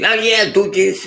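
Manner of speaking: high, loud quoted exclamation; eager mock agreement. Teacher mimicking people who say a lot of things, eager mock agreement.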